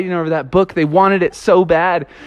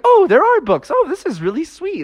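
much higher, delighted cry; thrilled friends; 13 semitones higher than usual. Retold or imitated. Friends find books in the wave, high and delighted. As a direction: gush like kids finding treasure.

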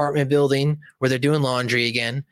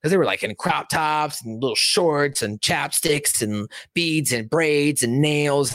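usual speaking voice

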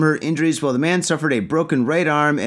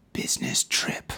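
whisper; 8 dB quieter than usual. Real, not acted. Aside about shady trip.

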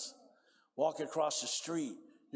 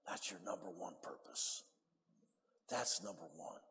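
softer, whispered emphasis; whispered point; 7 semitones lower, 7 dB quieter than usual. Acted. Preacher telling the congregation their purpose, whispered. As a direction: drop to a whisper to drive it home.

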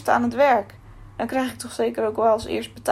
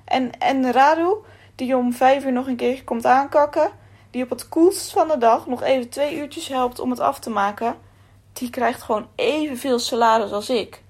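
raised, loud and indignant rant; angry outburst; 3 semitones higher, 5 dB louder than usual. Acted. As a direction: let Akos vent his fury at the boss. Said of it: "Akos viel meteen uit".

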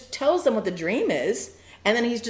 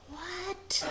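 shocked Reuben; 11 semitones higher, 9 dB quieter than usual. Retold or imitated.

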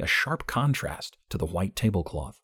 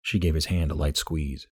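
plain reading voice

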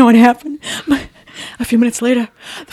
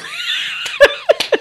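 squealing high laughter; 3 dB quieter than usual. Real, not acted. Laughing at do not call.

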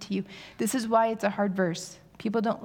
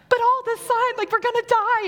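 much higher, louder, hammed-up panicked squeal; wife's mock panic at husband's plan, much higher and louder; 7 dB louder than usual. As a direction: squeal it like we're all going to die. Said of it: "And I'm like".

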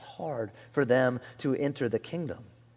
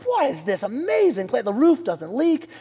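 high, amazed mock-royal voice; old Persian king; 10 semitones higher, 4 dB louder than usual. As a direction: marvel grandly like a king of old, accent made up. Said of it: "like, an old Persian king showed up".

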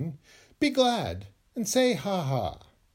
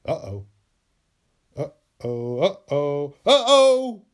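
hammed-up guilty dread; mock guilt building; 6 dB louder, slower than usual. Shown as a demonstration. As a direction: caught red-handed, getting worse. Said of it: "Feel guilt and say".

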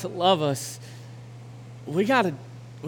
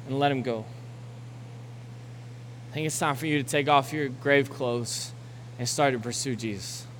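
lower, softer, tender plea; gentle closing appeal; 4 semitones lower, 5 dB quieter than usual. Acted. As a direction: ease them toward prayer, gently. Soft, slow closing appeal.

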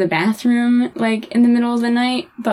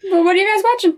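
put-on high, nosy child voice; little kid; 8 semitones higher, faster than usual. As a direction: play a curious kid stalling before bed. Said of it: "when I was little".

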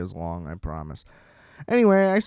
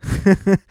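short laugh; 7 semitones higher, 4 dB louder than usual. Real, not acted. Laughs at own joke.

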